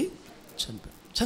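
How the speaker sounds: చనిపోయాడు whispered very slowly and softly, no voice; hushed whisper. Shown as a demonstration. Pastor answering his own question about Absalom's death, slow whisper. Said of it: "అబ్షాలోము ఏమేమిటి చెప్పండి".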